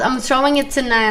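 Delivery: ordinary talk, nothing marked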